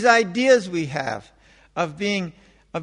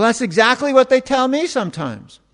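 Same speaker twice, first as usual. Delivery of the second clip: ordinary delivery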